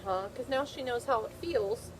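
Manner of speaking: normal talking voice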